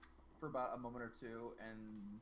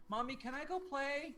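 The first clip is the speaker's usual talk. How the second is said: put-on pleading little girl; 12 semitones higher, 5 dB louder than usual. Little girl asking mom to go play, calling eagerly. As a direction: sing out the request like a small child.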